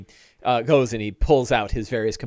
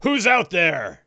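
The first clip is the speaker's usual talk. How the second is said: loud, slow, higher orc challenge; echoing orc call; 5 semitones higher, 5 dB louder, slower than usual. Acted. Orc lookout calls toward hidden intruders, echoing shout. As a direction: call out slowly as a suspicious orc. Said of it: "you hear a voice that echoes out".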